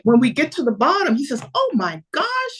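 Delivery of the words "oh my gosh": much higher-pitched mock amazement; retold astonishment. Man running into her at the stairs, imitated surprise. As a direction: act out amazement, pitch leaping up. Acts his surprise at meeting again.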